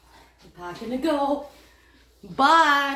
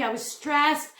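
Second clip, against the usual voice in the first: ordinary delivery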